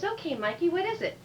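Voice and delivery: high, lilting teacher voice; encouraging teacher. Acted. Teacher calling on Mikey, high and encouraging.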